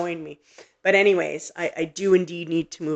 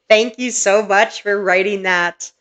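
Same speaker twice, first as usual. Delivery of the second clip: tag grateful excitement; 3 semitones higher, 8 dB louder, slower than usual. Real, not acted. Grateful for confirming message.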